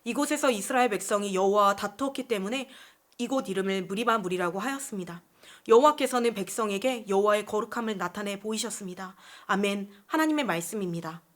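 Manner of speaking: low, soft formal reading; solemn reading aloud; scripture reading. Acted. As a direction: read the Bible passage in a measured, subdued voice. Reads the scripture passage.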